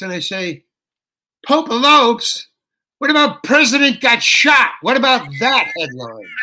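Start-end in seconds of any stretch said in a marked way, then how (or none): 1.5-6.3 incredulous outburst. Retold or imitated. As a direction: throw the tired headline back at them in outrage. Mocking the audience's stale headline.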